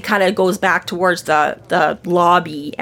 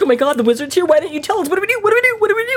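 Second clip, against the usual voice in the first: mock panic climbing higher and higher; freaking out; 10 semitones higher, much faster than usual. Friend imitating Fix learning the wizard is here, panicking. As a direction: squeal out repeated questions in acted alarm. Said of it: "who got all freaked out".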